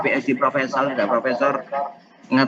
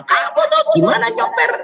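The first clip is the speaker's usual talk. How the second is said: angry father. Acted. Father angrily scolds his child after the car crash, very high. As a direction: snap at the careless driver. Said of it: "ayahnya langsung marah".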